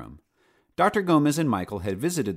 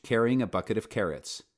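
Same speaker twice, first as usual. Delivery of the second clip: ordinary delivery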